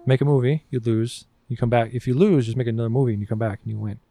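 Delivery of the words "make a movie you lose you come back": normal talking voice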